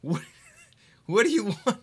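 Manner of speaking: ordinary delivery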